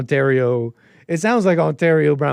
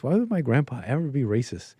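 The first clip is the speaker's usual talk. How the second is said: normal talking voice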